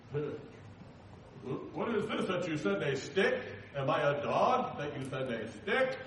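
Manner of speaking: slow, mocking drawl with a laugh; sneering giant. Goliath taunting David with a slow laughing sneer. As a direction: toss the insult with a sneering chuckle.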